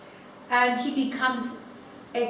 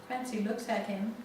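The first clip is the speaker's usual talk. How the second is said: ordinary talk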